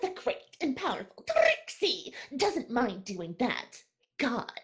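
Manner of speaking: grand, boastful pony showwoman voice; Trixie voice. Acted. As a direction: announce yourself like a vain stage magician. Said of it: "your Trixie impression".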